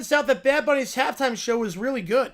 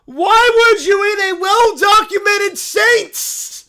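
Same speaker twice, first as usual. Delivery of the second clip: high, loud, slow cartoon bear voice; a cub thrilled by the news; excited little bear; 10 semitones higher, 11 dB louder, slower than usual. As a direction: squeal it like an overjoyed cub. Bear character shouting.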